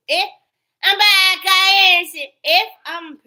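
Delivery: high, loud mock voice of her; loud mocking imitation. Mocking the woman's claim of innocence, loud high parody. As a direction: parrot her like a taunt.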